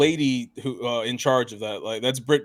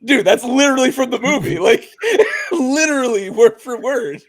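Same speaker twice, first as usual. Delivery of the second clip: higher and louder, laughing through the words; cracking up as you say it; excited laughing outburst; 10 semitones higher, 8 dB louder than usual. Retold or imitated. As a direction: shout it out in laughing disbelief. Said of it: "And I was like".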